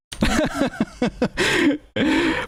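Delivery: long, high-pitched laughing fit; helpless, drawn-out laughter; real laugh. Real, not acted. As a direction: crack up in high bursts. Laughs at it being so American.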